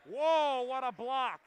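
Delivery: loud amazed whoa; excited shout. Real, not acted. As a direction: burst out at a great play. Big block in the game.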